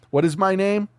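hammed-up boss berating his team; movie tirade. Quoting the movie boss yelling at weak salesmen, hammed-up fury. Quoting Glengarry Glen Ross speech.

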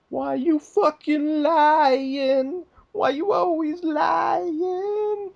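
very high, slow, wailing whine; hammed-up sobbing shout, drawn out; mock whiny accusation. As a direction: whine it like a bratty kid crying foul. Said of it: "Masaru tells him".

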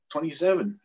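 recruit's reply. Retold or imitated. Recruit answers captain.

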